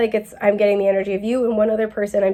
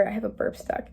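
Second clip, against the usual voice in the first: breathy whispered aside, much softer; 11 dB quieter than usual. Real, not acted. Whispers to viewers about a stuck burp. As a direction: drop to a whisper mid reading.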